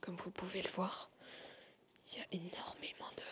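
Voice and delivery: hushed exploring whisper; nervous night vlog whisper. Real, not acted. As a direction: whisper so nobody hears you. Exploring abandoned hotel, whispering.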